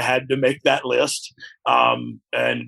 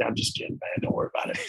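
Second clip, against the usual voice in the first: quiet reassurance; 3 semitones lower, 11 dB quieter, faster than usual. Retold or imitated. Boss voiced reassuring him.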